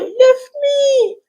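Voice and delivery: put-on high tearful little-child plea; crying toddler. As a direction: beg to be lifted like a teary toddler. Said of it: "she'll tell you".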